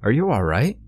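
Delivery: concerned, rising voice to a stranger; anxious question toward a distressed man; worried call. A narrator's reading. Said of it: "I called out worriedly".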